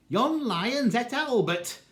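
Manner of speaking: mother hollering high and loud in broad Lancashire; hollered. Acted. Mother hollers to the zoo that the lion ate Albert, high loud cry. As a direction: cry it out high, alarmed, Yon lion's et Albert. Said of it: "she hollered".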